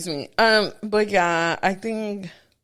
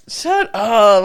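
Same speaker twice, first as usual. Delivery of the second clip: louder imitation of his dismissal; mock macho retort; 5 dB louder than usual. Imitating her macho man telling her off, mock stern. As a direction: put him on, firm and loud. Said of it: "He was trying to be macho".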